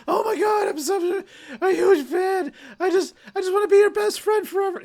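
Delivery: mock an overeager fan who wants to be besties; gushing superfan. Mocking fans who meet a celebrity, high, loud squealing gush. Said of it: "so many people are like".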